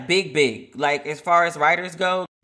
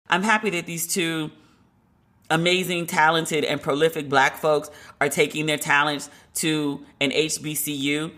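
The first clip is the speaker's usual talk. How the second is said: ordinary talk, nothing marked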